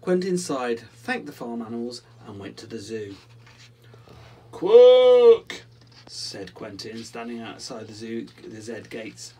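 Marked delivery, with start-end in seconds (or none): 4.5-5.6 "Quack!" as a long, blasting high "kwoook"; very loud, high duck honk stretching "Quack!" on "oo"; double-O quack. Acted. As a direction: hold a broken "oo" in "Quack!" at full volume. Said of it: "trying out both the O's".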